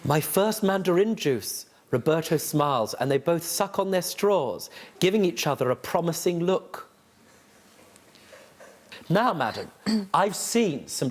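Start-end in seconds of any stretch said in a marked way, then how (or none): 0.0-6.9 reading the novel aloud with dry relish. Acted. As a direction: judge reads the novel aloud in court. Judge reads from the novel.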